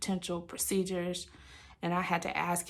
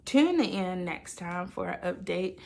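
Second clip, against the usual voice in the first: usual speaking voice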